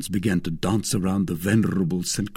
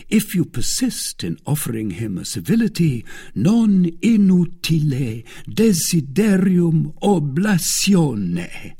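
D'Artagnan mock-quoting Latin; 8 semitones higher, 5 dB louder than usual. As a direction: draw out the Latin pompously. D'Artagnan quotes Latin teasingly.

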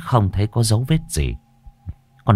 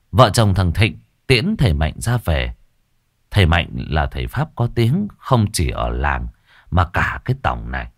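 plain reading voice

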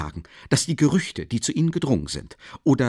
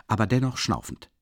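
plain reading voice

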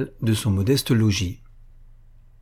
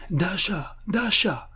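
calling out; 9 semitones higher, 3 dB quieter, much slower than usual. A narrator's reading. Mistress calls to her chambermaid, high restrained call. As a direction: call to the maid in a high, light voice. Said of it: "cria-t-elle à sa femme de chambre".

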